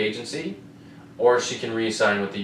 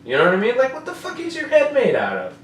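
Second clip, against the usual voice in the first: high, loud disbelieving shout, laughing through it; stunned disbelief; 13 semitones higher, 9 dB louder than usual. Retold or imitated. Telling her co-host about the fight, shouting high in loud disbelief. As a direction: yell it like you can't believe what you saw. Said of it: "and I'm just like".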